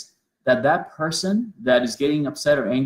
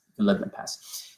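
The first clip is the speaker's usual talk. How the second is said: breathy whispered repeat, letting it drift; soft unvoiced echo of the line; 7 dB quieter than usual. Shown as a demonstration.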